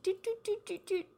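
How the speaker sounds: high little doots; string of short, high sound effects; playful doot noises. Acted. Showing how I rotate my little foods, high playful doots. Imitating rotating round her food.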